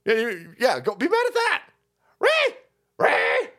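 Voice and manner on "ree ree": mock screech.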